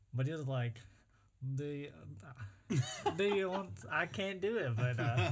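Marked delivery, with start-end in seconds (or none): 1.6-3.7 attempted Russian accent. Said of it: "I don't know how to do a Russian voice".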